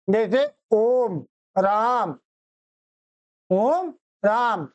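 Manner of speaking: ओम राम stretched long and slow, each vowel held out; prolonged pluta vowels. Shown as a demonstration. Teacher stretching ओम राम for pupils, each vowel drawn out slowly. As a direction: hold the vowels of ओम राम for three counts. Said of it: "प्लुत स्वर की सहायता से".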